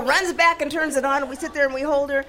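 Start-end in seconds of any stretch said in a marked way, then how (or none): none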